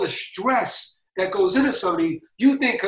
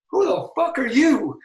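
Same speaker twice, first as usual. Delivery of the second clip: mock incredulous question, higher and drawn out; incredulous brush-off; 7 semitones higher than usual. Boss to an unknown caller, higher, slow, incredulous brush-off. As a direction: play a big boss who has never heard of you. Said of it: "And I'm like".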